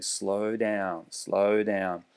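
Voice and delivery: soft steady rhythmic "slow down"; rhythmic command. Shown as a demonstration. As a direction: pace "slow down" like a slow basketball bounce. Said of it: "as if I'm doing a slow motion bounce of a basketball".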